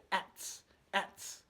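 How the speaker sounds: ats breathed with no voice; voiceless ats beat; whispered ats. Shown as a demonstration. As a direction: hiss ats like a hi-hat. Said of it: "and then you say".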